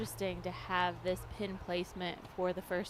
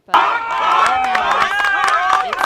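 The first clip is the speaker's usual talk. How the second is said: laughing; 13 semitones higher, 18 dB louder, slower than usual. Real, not acted. Commentator laughing over shot.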